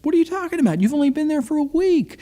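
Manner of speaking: high-pitched, disbelieving retort; incredulous. Retold or imitated. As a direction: question a friend as if they're overreacting. Reacting to friend wanting to quit.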